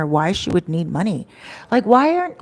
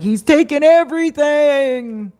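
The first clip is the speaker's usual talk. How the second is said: higher, slow, tearful voice of the friend; a touch of her crying, pitched up; tearful mimicry; 8 semitones higher, 5 dB louder, slower than usual. Crying friend quoted in story.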